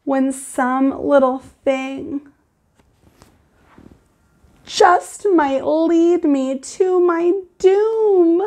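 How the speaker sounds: put-on reading to children. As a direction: read it like story time for the class. Teacher reads picture book aloud.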